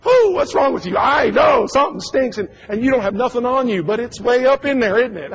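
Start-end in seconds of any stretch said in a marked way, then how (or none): 0.0-1.0 high, loud startled shout; wife's alarm. Retold or imitated. Wife greeting husband at the door, loudly alarmed. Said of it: "your wife's like". 1.0-2.4 higher, loud fretful reply; husband agreeing. Retold or imitated. Husband answering his wife, loud and flustered. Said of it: "your wife's like".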